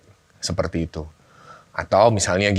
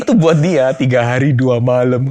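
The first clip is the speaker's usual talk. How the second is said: real laughter, louder and higher; laughing; 4 semitones higher, 4 dB louder than usual. Real, not acted. Guest agreeing with the host about commitment, laughing.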